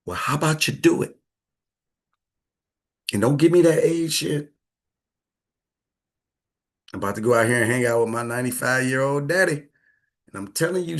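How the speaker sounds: normal talking voice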